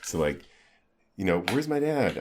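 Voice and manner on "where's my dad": put-on higher-pitched child's question; kid's innocent question, pitched up; imitating a kid. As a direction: lightly voice a curious kid. Voices his young kids asking.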